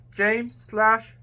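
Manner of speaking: ordinary delivery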